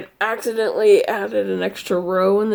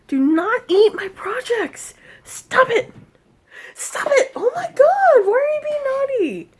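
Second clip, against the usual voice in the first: scolding the cat; 14 semitones higher, 4 dB louder than usual. Real, not acted. Owner scolding her cat for eating projects, high pet-talk scolding. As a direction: fuss at a naughty pet in a squeaky voice.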